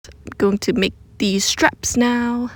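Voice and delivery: ordinary talk, nothing marked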